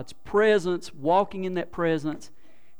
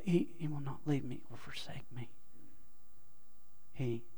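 soft, low murmur to yourself; quiet muttering; 5 semitones lower, 14 dB quieter than usual. Shown as a demonstration. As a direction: pray it under your breath. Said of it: "you kind of mutter".

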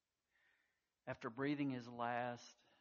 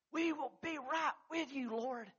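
eager pledge; 13 semitones higher, 5 dB louder than usual. Acted. Disciples join Peter's vow.

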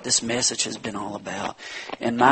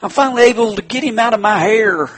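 high, loud gloating devil voice; a lightly hammed-up devil, pleased with himself; 11 semitones higher, 10 dB louder than usual. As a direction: crow like the devil spotting his chance. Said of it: "he says".

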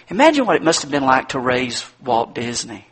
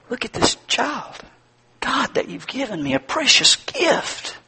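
high, softer, slow wonder; tender awe; 10 semitones higher, 4 dB quieter than usual. Acted. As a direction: marvel quietly at the precious gift. Parent awed, thanking God.

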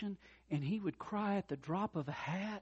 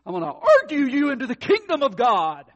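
loud, higher, hammed-up preacher arguing; bully him into faith, loudly; mock preachy argument; 11 semitones higher, 17 dB louder than usual. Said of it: "tried that real spiritual thing of".

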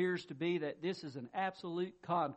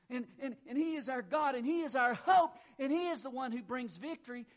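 rising, louder declaration peaking on "hope"; triumphant build; 4 semitones higher, 8 dB louder than usual. Real, not acted. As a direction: lift it like a victory cry. Preacher rises in triumph.

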